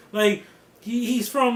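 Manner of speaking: usual speaking voice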